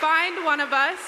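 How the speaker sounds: loud rising rally call; rally the volunteers; rally speech. Real, not acted.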